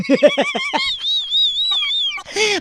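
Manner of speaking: long high-pitched laugh. Real, not acted. Uncle cracking up long and high after his own joke.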